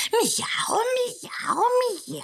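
high, crying mock meows; angry cat meow. Angry cat yowls at the kitchen door, high meowing. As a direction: yowl like an offended cat. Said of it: "haragosan karmizsálta".

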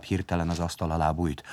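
usual speaking voice

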